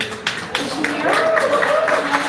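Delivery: very high, loud and slow, searching the crowd; calling out. Real, not acted. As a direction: call out to the audience, drawn out. Looking for mother in the audience.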